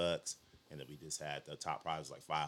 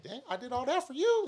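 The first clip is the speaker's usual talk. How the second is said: much higher, louder, almost tearing up; touched disbelief; 8 dB louder than usual. Retold or imitated. Telling a friend how a coworker's thanks hit him, moved high voice. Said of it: "made me almost tear up".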